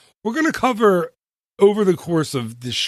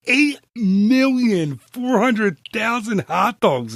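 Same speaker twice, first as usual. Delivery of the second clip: high, slow, drawn-out hype; excited; 8 semitones higher, slower than usual. Real, not acted. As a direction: build it like a big reveal. Builds up the big number.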